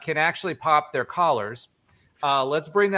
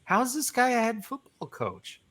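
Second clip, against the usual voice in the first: incredulous; 8 semitones higher, 3 dB quieter than usual. Retold or imitated. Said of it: "I was like".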